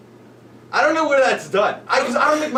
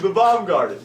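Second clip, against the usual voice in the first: ordinary delivery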